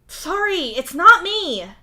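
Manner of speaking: high, loud protest; insistent. Acted. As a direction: insist at the top of your voice. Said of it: "he insists".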